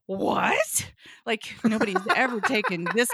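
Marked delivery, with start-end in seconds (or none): 0.1-0.9 loud, disbelieving outburst; acted-out astonished protest; incredulous. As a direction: burst out in mock disbelief. Partner's incredulous reply, acted.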